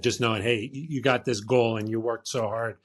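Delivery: ordinary talk, nothing marked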